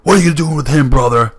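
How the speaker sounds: loud, high-pitched agitated wrestler impression; Hulk Hogan. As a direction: holler it like an alarmed wrestling star. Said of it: "Hulk Hogan's like".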